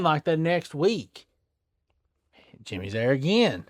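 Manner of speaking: ordinary delivery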